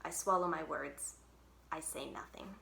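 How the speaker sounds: ordinary delivery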